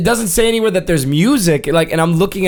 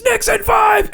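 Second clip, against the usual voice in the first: high-pitched wrestler's scream; screamed battle cry. Retold or imitated. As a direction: imitate a wrestler screaming a slogan. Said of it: "Blackout screams".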